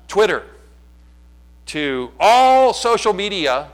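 loud, drawn-out sarcastic proclamation; mock scripture twist. Shown as a demonstration. Pastor mocking gossip culture to the church, sarcastic. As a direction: announce the gossip like holy writ, slowing on social media. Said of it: "here's what we do in the twenty-first century".

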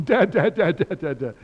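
loud, high, insistent repeated cries; imitated upset child. Cliff really upset and going for Dad, imitated loud wailing call. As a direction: mimic an upset little one calling out. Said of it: "when he's really upset".

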